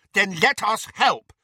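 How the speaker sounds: high, loud, angry demand. A narrator's reading. Neville demanding to be let in, angry. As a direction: insist angrily, voice pushed up. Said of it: "said Neville angrily".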